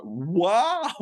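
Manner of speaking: retold wow of amazement; amazed wow. Quoting chess players' reaction to the comment, amazed wow. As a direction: draw it out like an impressed onlooker. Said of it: "They were just like".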